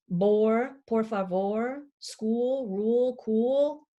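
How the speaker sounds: each rhyme word set apart and drawn out; "Bore", "por favor", "school", "rule", "cool" said slowly one by one; stressing the rhymes. Shown as a demonstration. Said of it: "Or is that a rhyming poem".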